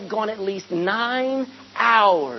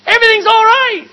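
calling out; 11 semitones higher, 13 dB louder than usual. Retold or imitated. Jesus calls out to frightened disciples in the boat, loud and high. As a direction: shout it over the wind and waves. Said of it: "A voice cries out".

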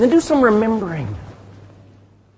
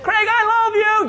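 high sing-out call from downstairs; high, affectionate holler; wife calling upstairs; 12 semitones higher than usual. Acted. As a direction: imitate a wife calling up to her husband. Wife calls upstairs to husband.

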